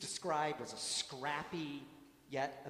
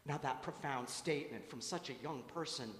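ordinary talk

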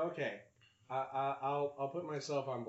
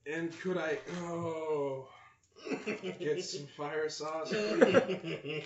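slow, slightly high strained request; restrained laughter; 5 semitones higher, 6 dB louder, much slower than usual. Retold or imitated. Customer asking the drive-thru for sauce, restrained, laughter escaping. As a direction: hold back laughter while imitating a polite order. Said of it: "so restrained when I was saying".

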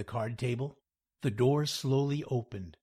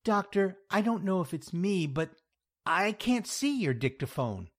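higher-pitched, hesitant woman client; doubtful Louise; 8 semitones higher, 4 dB louder than usual. Acted. Louise doubting her therapist, hesitant. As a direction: query it tentatively in a raised pitch.

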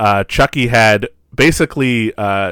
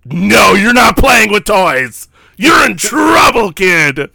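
higher, louder, slow scolding shout; stern teacher shout; 10 semitones higher, 7 dB louder, slower than usual. Retold or imitated. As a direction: bellow it down at the kid like a strict teacher. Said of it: "That's verbatim what she says in that voice".

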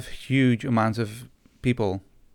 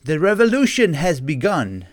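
louder, higher rallying cry; playful call to revolt; mock revolutionary proclamation; 4 semitones higher, 6 dB louder than usual. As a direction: proclaim it like a hammy rebel leader.

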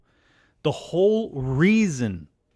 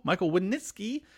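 ordinary talk

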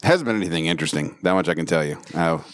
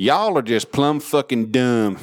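slow Southern drawl, pitched up; put-on Southern accent; 4 semitones higher, slower than usual. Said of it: "Think I'm gonna have an accent".

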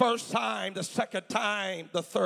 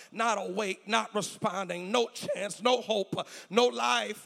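normal talking voice

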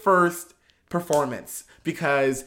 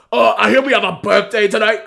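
louder, higher drag queen mic voice, hammed up; bad Candy Muse impression; 6 semitones higher, 10 dB louder than usual. Telling friends about the drag show, doing a bad Candy Muse on the mic. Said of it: "I do a bad Candy Muse".

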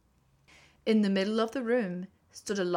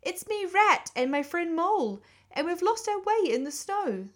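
high-pitched pleading Rat voice; small, high voice begging for shelter; crying out as Rat; 10 semitones higher, 5 dB louder than usual. A narrator's reading. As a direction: plead to be let in, high and urgent. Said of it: "cried the rat".

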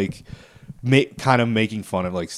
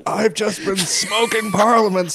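put-on high, slow old man voice; grumpy old man; 10 semitones higher, 4 dB louder, much slower than usual. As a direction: croak it out like a tired old man. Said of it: "Do old man voice?".

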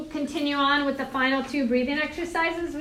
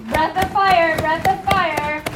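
raised, higher coaching voice; loud coaching; 3 semitones higher, 4 dB louder than usual. Real, not acted. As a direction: project to the whole room. Calling cues over class.

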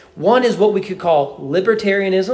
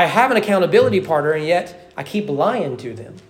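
ordinary talk, nothing marked

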